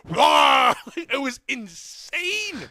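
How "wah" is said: long, loud, high mock retch; exaggerated throwing-up noise; imitated puking. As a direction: roar out a comic vomit. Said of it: "opened up her bag".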